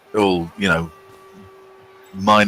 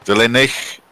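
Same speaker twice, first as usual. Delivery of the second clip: Villainich with a throaty Scottish or Dutch ch at the end; Scottish or Dutch try; 4 semitones higher than usual. Shown as a demonstration. Streamer tries Villainich in a Scottish or Dutch accent. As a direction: give Villainich a light Scottish or Dutch ch ending. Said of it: "how's my Scottish or Dutch?".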